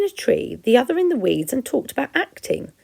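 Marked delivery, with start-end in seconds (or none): none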